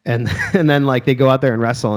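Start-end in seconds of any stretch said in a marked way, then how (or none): none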